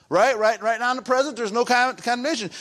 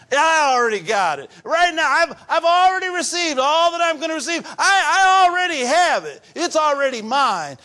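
preaching at full force; 6 semitones higher than usual. Acted. Preaching climax.

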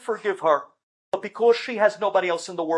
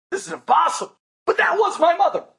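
stunned son who just buried his mother; shocked disbelief; 10 semitones higher than usual. Acted. Son on phone with nurse, stunned and high-pitched.

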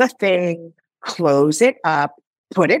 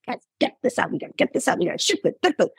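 manic urging; 8 semitones higher, 3 dB quieter, much faster than usual. Retold or imitated. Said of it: "I am manic".

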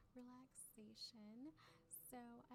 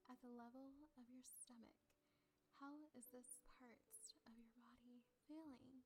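soft, unhurried body-scan voice with long pauses; hushed relaxation guide, leaving space between phrases; guided sleep meditation; 4 dB quieter than usual. Acted.

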